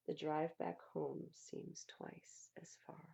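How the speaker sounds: slow, tired whisper; weary whisper. Acted. Child on the long drive home, slow weary whisper. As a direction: drag it out, exhausted.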